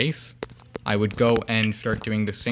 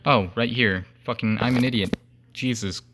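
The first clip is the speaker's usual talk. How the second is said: ordinary talk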